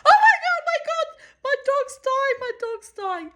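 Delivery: high, laughing mock panic; high mock panic at the vet; panicked. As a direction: replay the panic, half laughing. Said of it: "So I was like".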